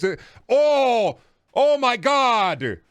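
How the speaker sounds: loud, high, drawn-out dismay; appalled outcry. Real, not acted. Streamer reacts to someone's mini taco confession, appalled. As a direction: wail it slowly, utterly appalled. Mock horror at mini tacos.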